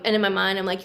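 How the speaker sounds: ordinary talk, nothing marked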